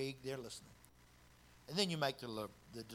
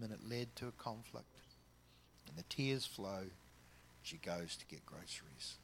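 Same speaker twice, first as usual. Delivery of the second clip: ordinary delivery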